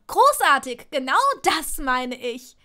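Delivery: loud, biting retort; exasperated sarcasm. A narrator's reading. Hermine answers his evasion, loudly exasperated. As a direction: burst out in angry sarcasm.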